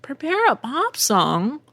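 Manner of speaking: high, drawn-out baffled question; incredulous echo. Acted. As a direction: repeat it slowly as a disbelieving question. Echoes the request in disbelief.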